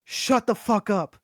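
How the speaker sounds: a hint of a sleepy angry father; tired dad. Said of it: "his tired face, he's like".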